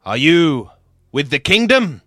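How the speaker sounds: loud, commanding challenge; shouting ringleader. Acted. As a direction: bellow the question at the prisoners. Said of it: "shouts out to you".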